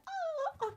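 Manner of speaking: high but quiet mock scream; soft squeals, only hinting at the panic; a light, quiet mock of her own scream; retold scream. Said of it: "still screaming".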